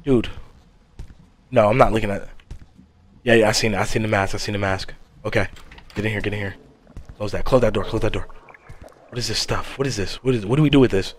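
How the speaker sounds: low, very soft scared whisper; whispering while hiding. Real, not acted. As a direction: hush it, the mask is near. Hiding from the mask.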